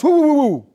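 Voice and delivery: high, loud yapping barks; imitated dog barking. Lecturer imitating the dog barking at a fearful walker, loud high yaps. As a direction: bark like an angry little dog. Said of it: "and the dog barks".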